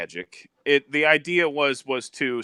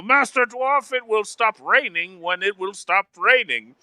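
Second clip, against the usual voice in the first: higher-pitched imitation of an exasperated wizard; Gandalf; 6 semitones higher than usual. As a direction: intone it like a wizard rebuking someone. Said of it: "And he's like".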